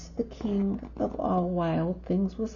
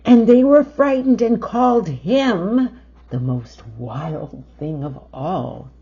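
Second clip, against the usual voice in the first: dramatic emphasis; 10 dB louder than usual. A narrator's reading. Dramatic climax read aloud.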